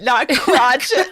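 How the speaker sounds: amused, teasing fix; breaking into laughs; laughing correction. Real, not acted. Mock correcting pronunciation, laughing.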